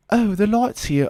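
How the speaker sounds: high-pitched mock British accent. Quoting the band's British merchandiser, mock British accent. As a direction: imitate a British woman in a put-on accent. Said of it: "she's British".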